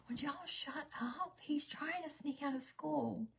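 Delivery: hissed, hushed plea to be quiet; urgent whisper. Retold or imitated. Mom hushing chatty friends at the school office, whispering. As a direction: whisper sharply so staff won't hear.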